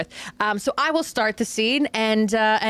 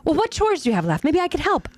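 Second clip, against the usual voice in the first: lightly acted, higher-pitched helpful friend; helpful friend Crystal; 6 semitones higher than usual. Crystal offering to help with chores, lightly acted sweetness. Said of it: "I will be playing the role of Crystal".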